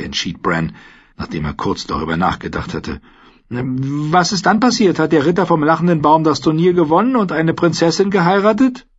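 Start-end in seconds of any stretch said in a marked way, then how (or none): 3.5-8.9 put-on eager young boy, high-pitched; bright curious child. Bran voiced as boy.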